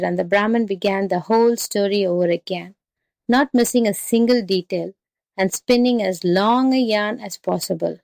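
ordinary delivery, nothing marked

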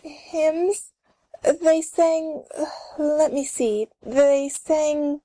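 hesitant, halting, searching for words; confused. Acted. Karen questioned about church by grandmother and neighbours, confused. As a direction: stall and leave it hanging. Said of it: "She stops in confusion".

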